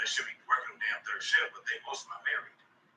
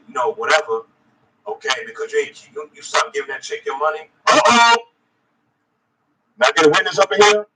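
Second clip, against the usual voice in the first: loud fired-up sermon voice; preaching; 4 semitones higher, 14 dB louder than usual. Acted. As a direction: preach it hard at the brothers.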